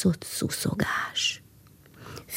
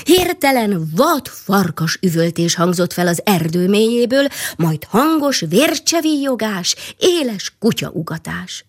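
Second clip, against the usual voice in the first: ordinary delivery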